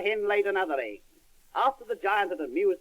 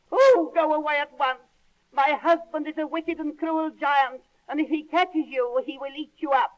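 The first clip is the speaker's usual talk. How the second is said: higher, louder frightened warning; 8 semitones higher, 5 dB louder than usual. Acted. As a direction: urge him away in a high scared voice. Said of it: "looked very frightened".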